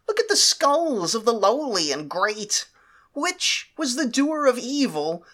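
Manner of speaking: high, eager servant voice; hunchback assistant. Acted. Slave agrees eagerly again.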